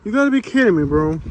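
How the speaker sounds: very high, loud "gotta" that falls away; real exasperation. Real, not acted. Vlogger venting to viewers about the ticket, exasperated. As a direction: throw up your hands in outrage.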